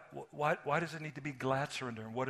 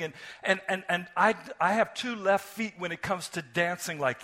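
loud, fervent sermon delivery; preaching at full force; 5 semitones higher, 11 dB louder than usual. Acted. Pastor preaching God's self-giving love to the congregation, loud and fervent. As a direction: preach it out to the whole room.

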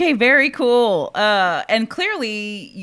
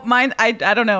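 normal talking voice

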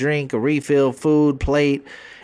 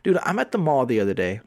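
usual speaking voice

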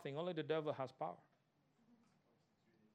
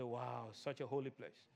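mock awe; 8 semitones lower, 10 dB quieter, slower than usual. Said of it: "You say".

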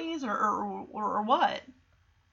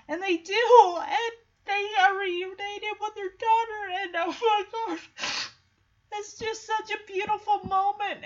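real choked-up voice, pitched high; tearful, moved; 9 semitones higher than usual. Real, not acted. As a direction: fight back tears as you tell it. Moved to tears retelling reunion.

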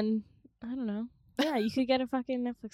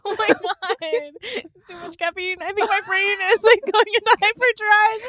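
high, squeaky held-back laughter; fighting back giggles while talking; stifled giggles; 11 semitones higher, 9 dB louder than usual. Real, not acted. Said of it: "I'm trying not to laugh directly into the mic".

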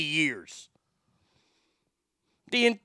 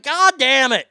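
"God damn it" blasted much louder, with higher pitch; furious curse; 8 semitones higher, 10 dB louder than usual. Retold or imitated. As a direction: spit out "God damn it" at full volume, fed up. After slamming car door.

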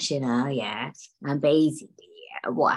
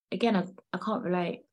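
ordinary talk

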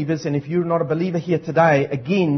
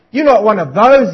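louder, higher sneering jab; a coworker jeering at grace; scornful coworker; 4 semitones higher, 8 dB louder than usual. Retold or imitated. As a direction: scoff at the believer. Coworker mocking man saying grace.

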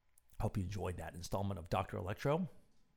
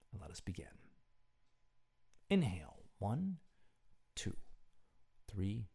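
guided breathing; 5 dB quieter than usual. Real, not acted. Host leading listeners to breathe before sleep, guided meditation. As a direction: count the breaths softly and evenly.

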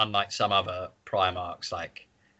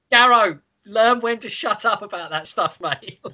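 high, louder, laughing telling-off of Garrow; mock scolding; 10 semitones higher, 4 dB louder than usual. Mocking a book character for talking too much, high teasing scold.

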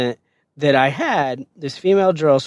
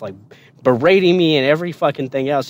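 ordinary talk, nothing marked

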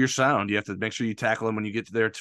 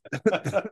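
short laugh; 11 semitones higher than usual. Real, not acted. Laughing about the stadium song choice.

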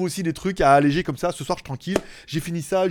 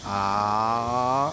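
in the dentist's chair; 6 semitones lower than usual. Real, not acted. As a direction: mouth wide open, saying ah. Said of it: "ouvre la bouche".

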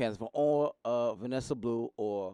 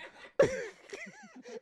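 high laugh. Real, not acted. Laughing at own story.

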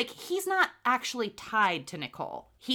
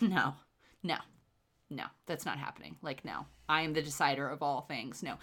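dismissive refusal; 8 semitones lower, 7 dB quieter than usual. Retold or imitated. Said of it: "they're like".

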